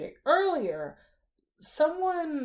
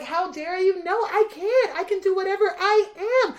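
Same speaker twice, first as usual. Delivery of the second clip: higher, louder, defiant; defiant outburst; 9 semitones higher, 9 dB louder than usual. Real, not acted. Answering a father's shaming voice, defiant and loud. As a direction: shout back your own worth. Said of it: "defiant self-affirmation".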